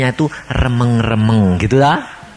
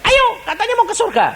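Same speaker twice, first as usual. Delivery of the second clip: very high teasing call; angel teasing; faster than usual. Acted. Angel leads the sinner to heaven, high and teasing. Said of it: "dibawa malaikat bilang".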